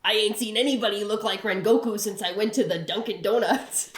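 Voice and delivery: hammed-up Jersey accent; comic New Jersey accent; Tanjiro with a Jersey accent. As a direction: gripe like a Jersey local. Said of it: "Tanjiro from Jersey?".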